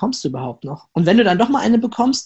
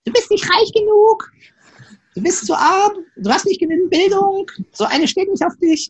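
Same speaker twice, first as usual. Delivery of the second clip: sehr hohe, alberne Comicmaus-Stimme; Mickey-Maus-Stimme; 12 semitones higher than usual. Shown as a demonstration. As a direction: quietsch es wie Mickey Maus. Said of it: "so wie die Stimme von Mickey Maus klingen zu lassen". (hohe Mickey-Maus-Stimme, die die Ausrede lächerlich macht)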